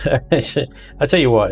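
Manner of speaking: normal talking voice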